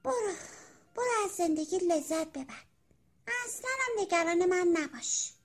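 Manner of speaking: high, wistful little caterpillar; mildly sorrowful, self-pitying farewell; sighing regret. A narrator's reading. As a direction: send them off with a rueful sigh. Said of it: "با آه و افسوس".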